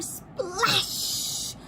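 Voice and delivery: ordinary delivery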